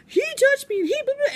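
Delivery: put-on boy rambling accusations at a classmate; whiny tattling kid. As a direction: tattle like a wound-up six-year-old. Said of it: "Because he'll be".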